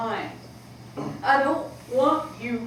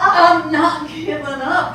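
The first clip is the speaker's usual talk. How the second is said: louder, slower and higher, insisting; loud insistence; 5 semitones higher, 12 dB louder, slower than usual. Acted. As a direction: she pushes back at her mother hard. Daughter insists.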